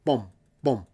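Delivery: breathy imitated boom, no voice; whispered boom. Shown as a demonstration. Guitar teacher demos a boom, whispered. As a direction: whisper the boom sound as a demo. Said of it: "boom, versus boom".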